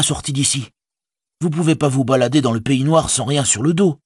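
high, chatty hobbit servant; plain-spoken Sam. A narrator's reading. Servant telling his master of dead orcs, high and chatty. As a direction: pitch it well up, practical and talkative. Sam's dialogue in narrated story.

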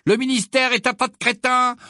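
high, loud proclamation; thunderous, high-pitched heckle; booming voice. A narrator's reading. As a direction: blare the insult out like a talking poster. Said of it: "d'une voix tonitruante".

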